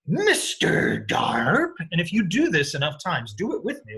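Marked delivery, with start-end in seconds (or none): 0.1-1.7 slow, loud, stretched goofy character voice; Mister Darp voice. Retold or imitated. Teacher voicing Mister Darp again for the class. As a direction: drag the name out like a cartoon oddball. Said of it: "make a weird voice".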